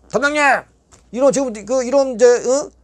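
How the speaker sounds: 높이 치솟은 외침; 멀리 있는 교도관을 소리쳐 부르듯; 다급히 부르기. Retold or imitated. (교도관에게 높고 다급하게 외침)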